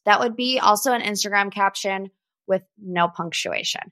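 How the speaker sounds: ordinary talk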